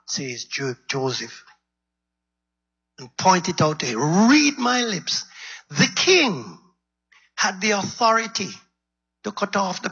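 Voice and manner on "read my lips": high, emphatic "Read my lips."; pointed challenge. Acted. The prophet Nathan daring the king, high and pointed. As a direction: throw it at the king as a dare.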